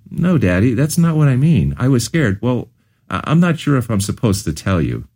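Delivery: a small daughter nervously confessing to her dad, voiced lightly; hint of a scared little girl.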